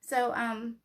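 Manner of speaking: ordinary talk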